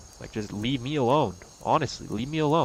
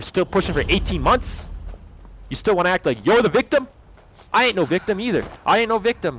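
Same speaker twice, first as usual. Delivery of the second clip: higher, heated protest; 7 semitones higher, 3 dB louder than usual. Real, not acted. Denying being the victim, heated. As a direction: push back hard and high.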